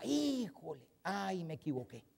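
soft, sheepish realisation; hushed regret. Acted. Speaker mimics someone caught in the wrong, hushed regret. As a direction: let the mistake sink in quietly, acting it out. Acting out dawning regret after a mistake.

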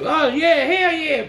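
high, hyped "hell yeah" in mock enthusiasm; mock eager cheer. Mocking eager protesters.

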